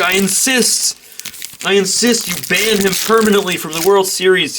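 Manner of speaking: high, prim insistent demand; mock insistence. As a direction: insist like an affronted player.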